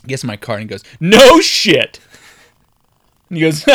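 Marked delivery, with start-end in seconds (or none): 1.0-2.0 high, loud sarcastic jab; imitated loud sarcasm; mocking cop. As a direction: boom it out in fake amazement. Cop mocks the driver's licence.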